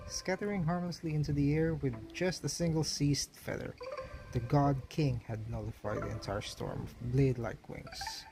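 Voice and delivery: usual speaking voice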